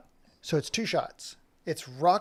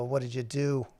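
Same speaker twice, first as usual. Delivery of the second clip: ordinary delivery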